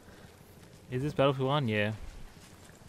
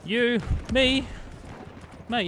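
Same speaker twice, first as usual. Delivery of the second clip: called out high and loud; excited shout to a teammate; loud greeting; 13 semitones higher, 7 dB louder, much slower than usual. Real, not acted. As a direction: hail a mate from across the map. Greeting a player loudly in-game.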